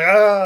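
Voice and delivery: loud, high wrestling cry; big struggling shout; mock battle yell. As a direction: yell as if grappling a beast. Mimes wrestling a stuffed tiger.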